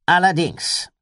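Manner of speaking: laut, mit gehobener Stimme; bestätige es mit vollem Nachdruck; nachdrückliche Bestätigung. A narrator's reading. (laut und bestimmt bestätigt)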